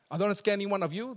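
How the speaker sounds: ordinary talk, nothing marked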